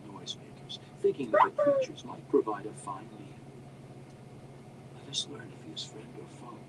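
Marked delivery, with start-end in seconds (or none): 5.0-6.6 soft whispered suggestion; hushed, barely voiced. A narrator's reading. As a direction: whisper it to a companion in hiding. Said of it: "Drizzt whispered".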